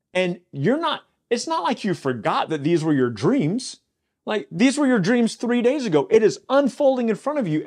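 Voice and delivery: can't believe he forgot, let it climb; incredulous. Real, not acted. Preacher incredulous at forgetful cupbearer.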